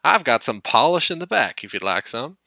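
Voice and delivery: lightly voiced helpful lorry; cheerful offer. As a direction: chirp a friendly offer, loud and high.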